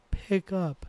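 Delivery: "pick up" lifted very high, then falling; ticked-over words. A narrator's reading. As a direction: tick "pick up" up high and let it drop. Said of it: "the way it ticked over".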